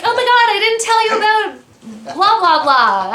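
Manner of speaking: high, loud mock-excited outburst; gushing afterthought. Texting a friend later about forgotten favorite shows, mock-frantic gushing. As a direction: blurt it out in a mock frantic squeal. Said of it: "I'll probably text you later and be like".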